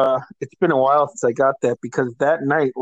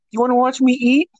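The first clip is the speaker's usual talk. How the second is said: much higher, mocking taunt; taunting friend; 9 semitones higher than usual. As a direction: rub it in playfully. Friend taunting while eating.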